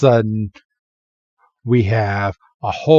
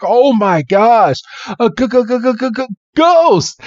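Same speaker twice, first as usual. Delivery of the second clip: terrified stammer; 11 semitones higher, 3 dB louder than usual. Retold or imitated. Red faces the skull Psyduck, stuttering in terror. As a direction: stutter out ghost, the pitch climbing in panic. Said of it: "And Red's like".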